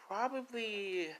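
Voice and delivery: high, drawn-out pondering; musing, slow and high-pitched; Lisa. Acted. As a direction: stretch it out in a high girl's voice. Lisa pondering friend's question.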